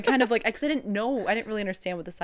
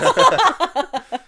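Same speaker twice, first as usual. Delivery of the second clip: loud laughter; 9 semitones higher, 13 dB louder than usual. Real, not acted. Big laugh.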